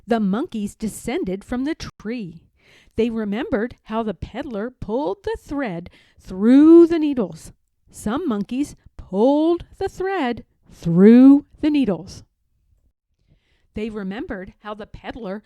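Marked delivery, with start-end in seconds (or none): 3.0-12.3 put-on louder, lively read-aloud for children; eager narration to kids; building storytelling. A narrator's reading. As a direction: push the energy up as the monkeys sew. Storyteller building the sewing scene.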